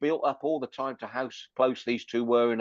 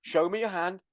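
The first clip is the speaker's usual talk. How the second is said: insistent mock demand; 6 semitones higher than usual. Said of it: "And she goes".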